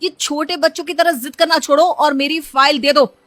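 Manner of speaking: annoyed scolding. Acted. Husband scolding his wife over the hidden file, loud and annoyed. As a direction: scold her like a child.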